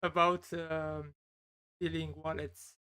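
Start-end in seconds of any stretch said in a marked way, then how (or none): none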